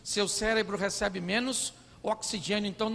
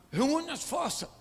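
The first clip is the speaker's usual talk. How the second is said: bracing himself, pitched up; mock weary effort; 6 semitones higher, slower than usual. Tired husband bracing to hear his wife, mock effort. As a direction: summon your strength with comic effort.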